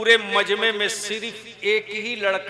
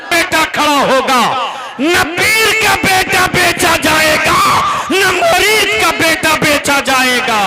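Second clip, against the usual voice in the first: shouting; 8 semitones higher, 9 dB louder than usual. Real, not acted. Preacher vowing no son will be sold, shouting. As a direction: thunder it from the stage.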